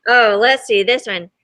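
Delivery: plain reading voice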